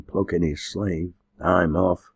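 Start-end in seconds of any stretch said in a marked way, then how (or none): none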